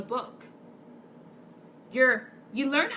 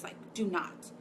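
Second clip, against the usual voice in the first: hushed whispered warning; 3 semitones lower, 7 dB quieter than usual. Retold or imitated. Mocking the suppressors who say not to shine, whispered. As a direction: whisper it like a secret command.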